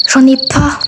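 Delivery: ordinary talk, nothing marked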